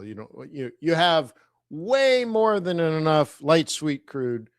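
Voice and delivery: ordinary talk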